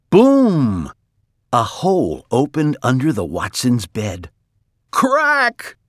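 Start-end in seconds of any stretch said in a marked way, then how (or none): 0.1-0.9 loud, high, drawn-out boom; booming crash. A narrator's reading. Narrator as a hole opens under the bed, booming crash. 4.9-5.8 very high, sudden crack; sharp cracking noise. A narrator's reading. Narrator as the bed falls in, sudden high crack. Floor breaks under the bed.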